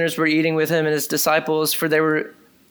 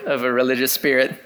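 ordinary talk

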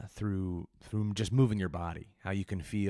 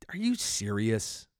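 ordinary delivery

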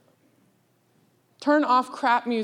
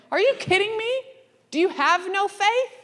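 high, incredulous rebuke; Jesus scolding the disciples, high and disbelieving; exasperated Jesus; 9 semitones higher than usual. Retold or imitated. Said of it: "and he's like".

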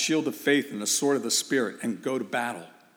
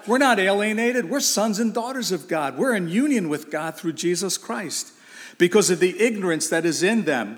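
fervent preaching; 4 semitones higher than usual. Acted. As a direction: raise the pitch, preach with rising force. Preaching climax, higher and louder.

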